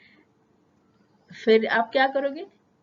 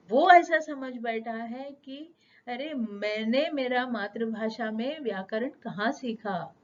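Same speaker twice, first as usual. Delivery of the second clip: ordinary talk, nothing marked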